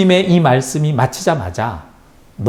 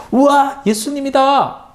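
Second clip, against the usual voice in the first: much higher, thrilled cry of a crowd member; excited crowd shout; 13 semitones higher than usual. A narrator's reading. Crowd spotting Jesus, much higher excited shout. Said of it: "사람들이 소리 지르며".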